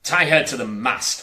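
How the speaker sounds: loud barked pirate order; shouted. A narrator's reading. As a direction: shout it to the crew. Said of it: "Hook shouted".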